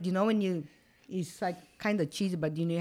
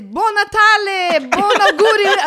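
mimicking Ricky; 13 semitones higher, 13 dB louder than usual. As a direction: belt out wrong wishes with proud confidence. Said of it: "he says".

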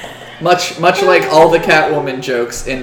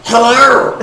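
raspy, higher and louder creature greeting; Gollum; 5 semitones higher, 6 dB louder than usual. Acted. Goblin robe seller greets customer, Gollum voice. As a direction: greet in a strangled creature rasp. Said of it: "I should do Gollum voice".

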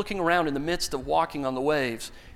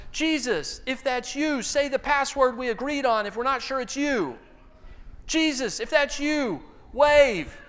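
disciples hailing Jesus; 7 semitones higher than usual. Acted.

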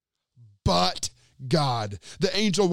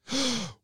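quick surprised gasp of recognition; sharp gasp; 6 semitones higher, 4 dB quieter than usual. Acted. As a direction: gasp as if you've just spotted him. Bystander recognizes Peter.

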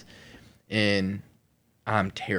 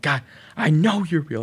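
raised, high mock plea to God; exasperated retold prayer; 8 semitones higher, 7 dB louder than usual. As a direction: protest loudly as in a retold prayer.

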